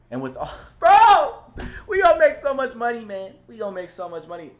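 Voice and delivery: high, loud excited laughing burst; thrilled. Real, not acted. Streamer to viewers, laughing with excitement about profits. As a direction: squeal with glee about the money. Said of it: "I'm more excited than I".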